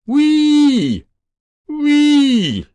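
high, loud whooping shriek; engine scream. Acted. Boy on the roof shrieking as he runs, high loud whoop. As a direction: let it ring out like a siren.

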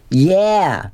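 narrated scream, held well back; mild mock scream. A narrator's reading. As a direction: echo the bully eagerly, without volume. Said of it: "Billy screamed".

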